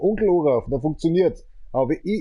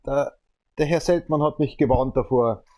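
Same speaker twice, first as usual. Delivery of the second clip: ordinary delivery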